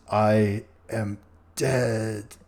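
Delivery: slow, hollow corpse voice; zombie. Acted. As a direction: drag out each word like the dead.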